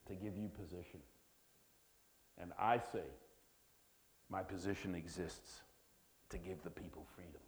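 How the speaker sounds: put-on an amateur Scottish accent, hushed and low. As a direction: quote Braveheart in a homemade Scottish accent. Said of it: "I don't know how my Scottish accent will work today".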